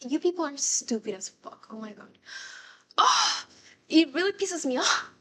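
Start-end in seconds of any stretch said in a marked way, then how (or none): 3.0-5.1 high, loud exasperated outburst; worked up at commenters, high and heated; real anger. Real, not acted. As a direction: vent irritation, voice jumping high. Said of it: "coming off super angry".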